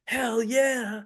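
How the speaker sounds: high-pitched celebratory cheer, quoting the dealer; imitating excited dealer. Player retelling how the dealer cheered the table, excited high whoop. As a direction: whoop it like a dealer caught up in the win.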